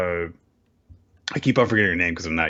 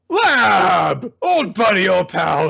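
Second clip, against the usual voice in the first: high, loud hammed-up holler; mock calling out; 13 semitones higher, 6 dB louder, much slower than usual. Gen hailing his old pal with an idea, hammed-up shout. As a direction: call out to an old pal, pitched way up.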